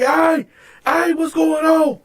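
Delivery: high, alarmed onlooker imitation; calling out. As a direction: imitate inmates hollering through bars. Inmates calling from the bars.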